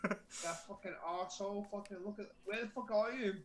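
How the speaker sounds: ordinary delivery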